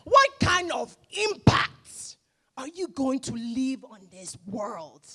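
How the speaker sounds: a hint of a Nigerian father's voice; Nigerian accent. Her Nigerian father asking about her impact, in a Nigerian accent. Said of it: "in his Nigerian accent".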